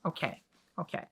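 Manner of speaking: soft, falling okays to calm down; self-soothing okays. Real, not acted. Reader calming herself before the chapter, soft okays. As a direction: talk yourself down softly.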